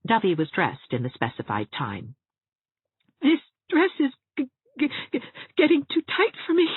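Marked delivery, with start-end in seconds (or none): 3.2-6.7 very high, stammering sobbed complaint; sobbing. A narrator's reading. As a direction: sob it out, sticking on the word. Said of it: "she sobbed as Anne hooked her up".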